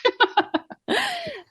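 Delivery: bright high laugh. Real, not acted. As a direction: laugh it off lightly. Laughs at own remark.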